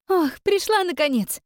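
put-on high tired young woman; weary girl. A narrator's reading. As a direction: sigh it out, worn out. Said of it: "устало сказала молодая девушка".

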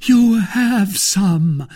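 high, loud, very drawn-out; impassioned cry. A narrator's reading. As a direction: burst out in a rapture. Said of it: "cried the procurator's wife in a transport".

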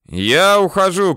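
high, loud cry of surrender; shouting. A narrator's reading. Bandit announces he is leaving, shouting. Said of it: "кричит Адик".

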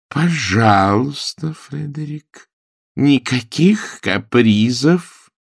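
slow, pointed, no nonsense; stern warning. A narrator's reading. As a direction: lay down the law slowly. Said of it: "сильный разряд динамита". (строгое медленное предупреждение)

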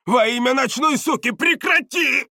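high, loud pleading yell; desperate shout. A narrator's reading. Бардак begs his tormentor to stop, high shout. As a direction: scream for it to stop. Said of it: "закричал он".

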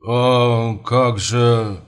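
very slow, drawn-out hesitation; flustered father. Acted. Startled father answering a marriage proposal, flustered and halting. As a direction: stall in bewilderment, stretching every word.

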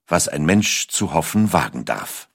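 ordinary delivery

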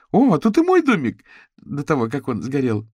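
high, loud delight sinking away; joy evaporating. A narrator's reading. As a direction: start thrilled, let the joy drain out. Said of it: "Вся радость тут же испарилась".

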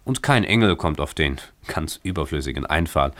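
ordinary delivery

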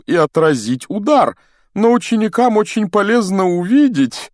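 very high, loud, effusive showmanship; loud bluster. A narrator's reading. Defeated teacher saving face before pupils, loud bluster. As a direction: gush loudly at the top of the voice.

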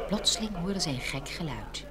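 ordinary delivery

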